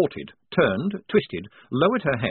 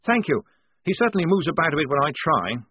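Blouse voice; 6 semitones higher, 4 dB louder than usual. A narrator's reading. Blouse to Polly.

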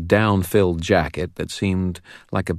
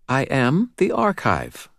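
put-on high child's voice, slow and sure; steady, assured child; 8 semitones higher, slower than usual. A narrator's reading. Girl calmly insisting to Dresden, slow and assured. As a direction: say it calm and certain, slowly. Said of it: "her voice steady and assured".

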